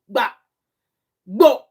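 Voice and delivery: loud, explosive banging noises; imitated table slams. Vlogger mimicking the interviewee slamming the table, explosive bangs. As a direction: spit out each slam as a short burst. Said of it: "she was slamming the table".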